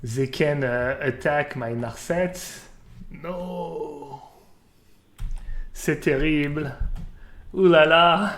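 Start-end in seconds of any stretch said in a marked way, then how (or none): 3.1-8.3 hammed-up French dismay, building to a loud ooh la la; mock French accent. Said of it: "wants me to speak with a French accent".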